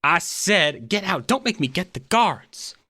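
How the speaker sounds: high, indignant stern guard. Acted. Guard ordering intruders out of the engine, stern warning. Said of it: "puts on a very stern scowl".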